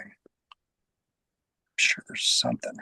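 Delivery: slow, near-whispered musing; hushed, drawn-out pondering; thinking aloud. Real, not acted. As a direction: whisper it to yourself while searching your memory. Thinking aloud, searching memory.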